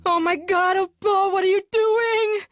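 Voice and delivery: high, loud panicked cry; horrified scream. A narrator's reading. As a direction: scream in helpless horror. Annie horrified by the fire.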